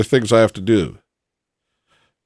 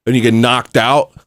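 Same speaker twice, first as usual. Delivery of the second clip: normal talking voice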